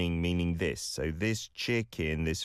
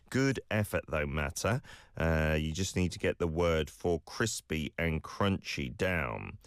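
ordinary talk

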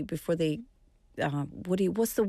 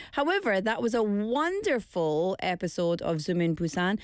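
ordinary delivery

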